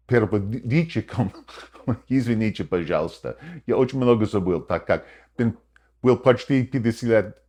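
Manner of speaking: fluent conversational Russian, unhurried; speaking Russian. Shown as a demonstration. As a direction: switch fully into spoken Russian. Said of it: "I'm about to say something in a foreign language".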